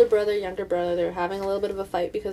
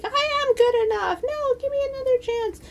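put-on very high, squeaky pleading child voice; protesting kid. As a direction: plead like a little kid, pitched way up. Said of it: "And then the kid's like".

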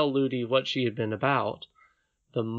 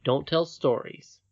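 slow, measured princess quoting the nurse; unangry quoting; 3 semitones higher than usual. Acted. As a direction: repeat the rebuke evenly, without shouting. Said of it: "did not feel at all angry".

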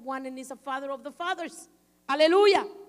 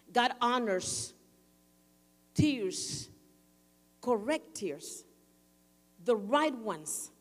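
slow emphasis; 3 semitones lower, much slower than usual. Real, not acted. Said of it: "slow emphasis on correct tears".

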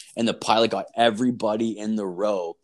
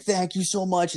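high, gushing thank-you in a lightly put-on woman's voice; relieved honeymooner thanking the drunk passenger, high and gushing; grateful woman; 10 semitones higher than usual. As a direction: gush your thanks up high like the relieved bride. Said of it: "She's like".

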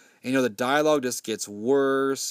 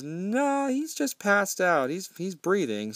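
mock alarmed protest; 6 semitones higher, slower than usual.

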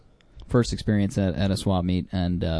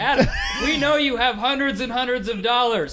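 much higher teasing falsetto, laughing; mocking high voice; 13 semitones higher than usual. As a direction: taunt him in a squeaky mock voice. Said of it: "play that game that I wanted to play".

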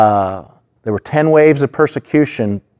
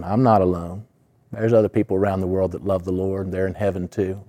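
softer, calm answer of a believer; soft steadfast reply; 3 semitones lower, 7 dB quieter than usual. Retold or imitated. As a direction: answer the jailer quietly and firmly. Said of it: "prisoner answers jailer".

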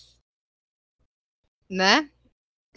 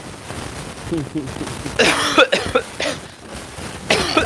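tosse seca. Real, not acted. Participante tosse no microfone.

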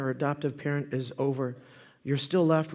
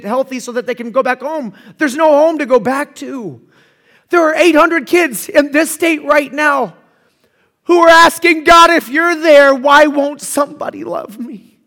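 higher, louder preacher's plea; impassioned preaching; 9 semitones higher, 12 dB louder than usual. Acted. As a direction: drive it home from the pulpit. Preaching at full force.

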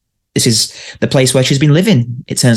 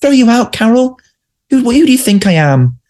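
imitating Brittas and Carol; 9 semitones higher than usual. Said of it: "he's like saying".

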